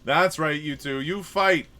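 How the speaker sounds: loud, high cheering on; excited cheering. Real, not acted. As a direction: egg them on loudly. Cheering allies in fight.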